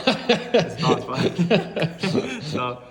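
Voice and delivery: laughing it off loudly; cracking up between words. Real, not acted. Friend's joke sets off laughter.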